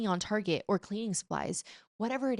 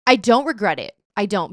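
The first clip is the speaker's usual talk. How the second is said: defiant; 9 dB louder than usual. Real, not acted. Defiant after admitting guilt.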